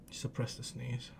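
very soft, low self-instruction; hushed. Real, not acted. Holding back a sneeze, hushed. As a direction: murmur it low to yourself.

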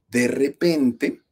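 "De repente" stretched out very slowly, falling at the end; slow suspense. Shown as a demonstration. Telling listeners a ghost story, drawn-out slow "De repente". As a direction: draw out "De repente" to build suspense. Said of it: "miren nada más".

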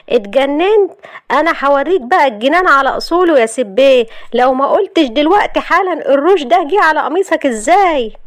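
high, mounting rage; furious threat. Acted. Wife confronting husband over lipstick stain, furious. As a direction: demand an explanation, getting fiercer.

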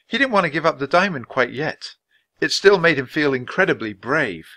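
usual speaking voice

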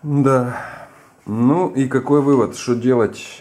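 usual speaking voice

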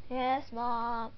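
usual speaking voice

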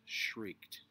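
plain reading voice

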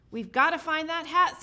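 a touch of nervous urgency. A narrator's reading. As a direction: press with mild worry. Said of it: "Santa said nervously".

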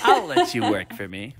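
slow, drawn-out villain imitation; evil voice. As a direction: stretch it out like a mock villain. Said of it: "like, this evil voice".

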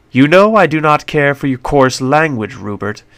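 lightly voiced prissy English doctor; a hint of a fussy British gent; mildly offended English accent; prim British accent. A narrator's reading. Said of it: "sounding like a whiny limey".

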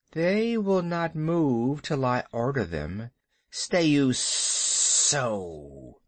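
slow, drawn-out serpent command; hissing snake. Acted. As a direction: hiss the order, stretching the final words. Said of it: "Kaa hissed".

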